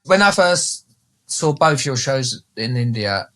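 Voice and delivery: ordinary talk, nothing marked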